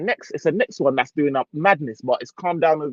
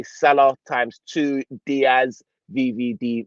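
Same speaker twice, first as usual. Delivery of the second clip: ordinary talk, nothing marked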